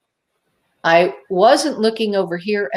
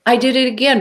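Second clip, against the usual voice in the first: usual speaking voice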